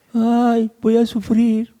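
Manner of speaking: high, whiny mock lament; a hammed-up high wail of self-pity; mock whimpering. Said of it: "No estaba ahí llorando, ni diciendo".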